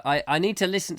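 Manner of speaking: usual speaking voice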